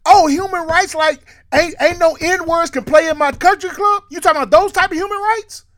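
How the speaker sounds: high-pitched mock-outraged retort; incredulous mock yell, pitched high; retold yell. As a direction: re-enact yelling in disbelief, without full volume. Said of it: "I yelled so loud".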